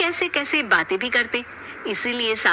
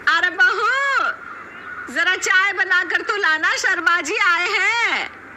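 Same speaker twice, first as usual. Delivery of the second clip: loud, higher call across the house; 9 semitones higher, 5 dB louder than usual. Acted. Mother-in-law calling out to daughter-in-law for tea, loud.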